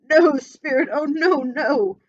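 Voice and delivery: loud, high anguished no; desperate pleading. Acted. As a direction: shout your denial in terror. Scrooge begs at the grave.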